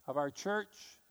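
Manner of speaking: usual speaking voice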